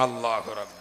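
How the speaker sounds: low, soft dismayed mutter under the breath; a low muttered oh Lord aside; muttered exclamation. Real, not acted. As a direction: sigh out a quiet oh my God. Dismayed aside at sad tale.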